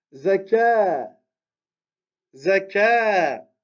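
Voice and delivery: "Zaka" with short "Za" and long held "ka"; lengthened final vowel. Shown as a demonstration. Teacher modelling the second line for students, "Zaka" with long "ka". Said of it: "l'allongement qu'on vient de voir".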